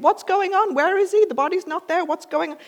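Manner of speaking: high, flustered questions; acting out waking into chaos; confused crowd. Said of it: "coming round from sleep".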